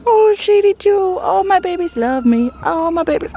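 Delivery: very high, doting squeal-coo. Real, not acted. Owner as all her goats crowd in, high gushing coo. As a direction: gush over the animals high in the voice.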